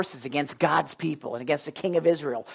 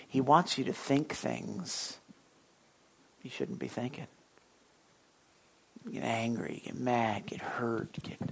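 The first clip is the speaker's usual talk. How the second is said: softer, dropping to a whisper on get hurt; hushed warning; 7 semitones lower, 11 dB quieter than usual. Acted. Preacher warning the congregation, hushed to a whisper. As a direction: lean in and whisper the devil's tricks. Preacher drops to intense hush.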